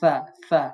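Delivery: সা said soft and thin, tongue near the teeth. Shown as a demonstration. Teacher showing students a soft thin sa. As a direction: say সা lightly, barik, tongue at the teeth. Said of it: "দাঁতের সাথে লাগিয়ে নরম করে পড়বেন".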